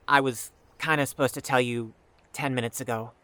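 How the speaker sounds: usual speaking voice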